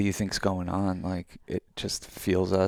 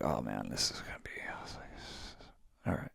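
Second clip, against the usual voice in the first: low, very soft grumble trailing away; retold dread, sinking to a near whisper; reluctant grumble; 10 semitones lower, 13 dB quieter than usual. Hiker recalling resisting the exercise at first, reluctant grumble. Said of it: "I was resistant at first".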